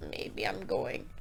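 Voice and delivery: soft whispered tease after a laugh; hushed coy aside; teasing whisper. Real, not acted. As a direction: whisper it playfully, unsure about leaving.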